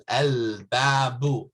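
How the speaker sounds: al-babu, the l said clearly and slowly; al-babu with the lam. Shown as a demonstration. Teacher slowly models al-babu for students, lam sounded. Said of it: "I'm pronouncing the lam".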